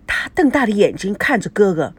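usual speaking voice

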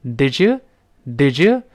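"did you" slow, the d and y blended into a z sound; "did you" run together slowly; linked did you. Shown as a demonstration. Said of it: "Elaine老师读成了".

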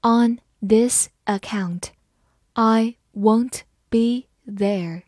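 slow, careful classroom read; slow teacher demo. Shown as a demonstration. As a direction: read it slowly word by word. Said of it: "好，慢慢来读".

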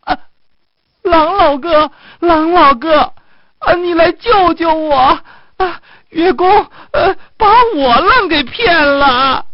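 high, loud fox wail; desperate wailing cry. A narrator's reading. Fox begs the wolf to save him, desperate wailing. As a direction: howl for help, high and at full force. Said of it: "哀嚎起来，拼命叫道".

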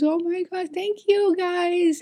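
very high, slow, delighted; gushing thanks. Retold or imitated. Thanking the cute kids for her phone, high gushing coo.

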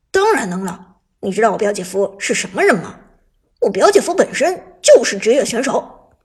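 loud proud declaration; loud boast. Acted. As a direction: announce it to the class. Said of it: "陈烨大声地说".

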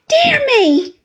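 startled cry. A narrator's reading. Meek doctor recognising an old acquaintance, crying out in surprise. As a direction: cry out in mild-mannered astonishment. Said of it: "cried Mister Chillip".

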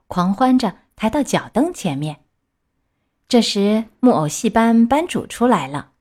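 plain reading voice

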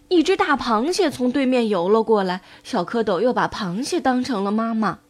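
usual speaking voice

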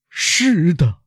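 high, drawn-out exclamation; distraught cry, high and held; anguished cry. A narrator's reading. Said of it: "威勒夫高声地说".

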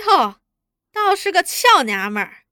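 mock admiration. A narrator's reading. Interrogator sizing up frightened girl, mock admiration. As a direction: tease her with pointed surprise. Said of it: "故意说".

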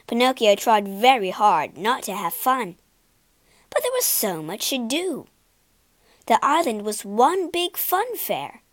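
usual speaking voice

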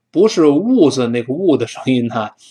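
usual speaking voice

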